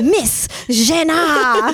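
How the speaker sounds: slow, high French announcement; hammed-up French accent, stretched out. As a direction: draw the name out like a Frenchman. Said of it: "get him to call me".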